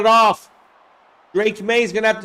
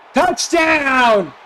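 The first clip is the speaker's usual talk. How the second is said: much higher and louder, slow drawn-out yell; touchdown shout; 6 semitones higher, 4 dB louder, slower than usual. Real, not acted. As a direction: erupt like a commentator on a score. Touchdown scored live.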